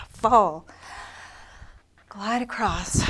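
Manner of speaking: winded, breathing hard between cues; puffing a little from the exertion. Real, not acted. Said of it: "I'm winded".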